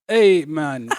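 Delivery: put-on loud amateur Jamaican accent; badly faked Jamaican lilt, on request. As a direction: belt out a brief mock-Jamaican greeting. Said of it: "Let me hear your Jamaican first".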